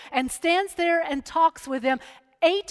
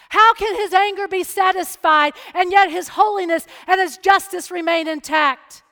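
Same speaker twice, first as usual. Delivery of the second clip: louder preaching, each question pressed home; full-force preaching; 3 semitones higher, 6 dB louder than usual. Acted. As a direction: preach it, build toward the answer.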